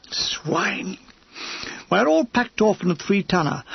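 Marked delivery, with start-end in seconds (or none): 0.1-1.0 whispered insult. Acted. Muttered insult at sergeant.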